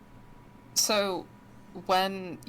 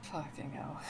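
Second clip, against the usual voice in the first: usual speaking voice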